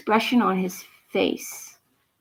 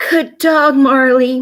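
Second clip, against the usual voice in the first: praising; 4 semitones higher, 7 dB louder than usual. Acted. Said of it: "Mommy said".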